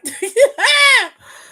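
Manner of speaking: loud, high cackle of laughter; shrieking laugh. Real, not acted. Streamer cracking up at chat comment, loud high cackle.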